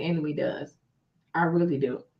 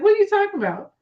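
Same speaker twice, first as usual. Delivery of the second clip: high, far put-on what are you talking about; mock surprise; 11 semitones higher, 4 dB louder, faster than usual. Wife dodging friend's prying question, mock surprised. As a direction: play innocent, high and startled.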